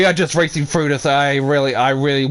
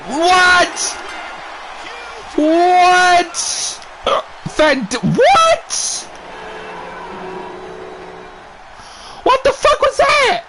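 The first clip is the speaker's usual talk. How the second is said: much higher, shouted disbelief; stunned disbelief; 13 semitones higher, much slower than usual. Real, not acted. Commentator watching his team lose in a flash, shocked shouting. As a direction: yell in outrage as the match ends. Shock at sudden pin loss.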